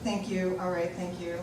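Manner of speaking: usual speaking voice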